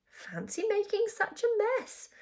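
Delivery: put-on high-pitched boyish marvelling; awed, high admiration; admiring. A narrator's reading. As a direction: say it with impressed wonder. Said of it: "Jonathan admiringly".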